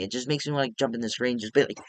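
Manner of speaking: normal talking voice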